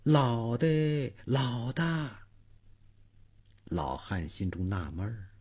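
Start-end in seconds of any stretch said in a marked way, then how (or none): 0.0-2.1 drawn-out, eerie faraway call; soft wailing call in the night; distant calling. Acted. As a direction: call faintly, stretching each syllable. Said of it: "还有人叫".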